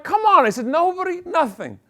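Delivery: high, strained and slowing on the last words; exasperated outburst. Acted. To the flight attendant, high exasperated complaint. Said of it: "But I went".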